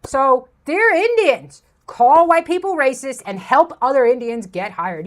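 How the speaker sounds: ordinary talk, nothing marked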